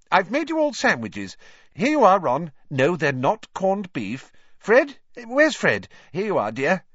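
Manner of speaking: high, fussing mum voice; high-pitched mum calling round her children; bustling mother. Acted. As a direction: busily hand things out in a high motherly voice.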